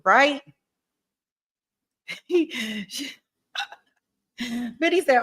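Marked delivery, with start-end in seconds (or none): 2.1-2.8 brief amused giggle; chuckle. Real, not acted. Amused reaction to chat.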